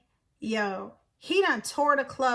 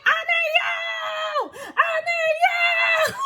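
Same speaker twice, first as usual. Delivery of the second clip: high, drawn-out shriek; screaming; 14 semitones higher, much slower than usual. Retold or imitated. Woman screaming at a man groping her on subway. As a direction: scream it full out, stretching the words. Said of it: "I literally turn around and just start screaming".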